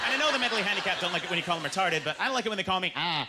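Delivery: normal talking voice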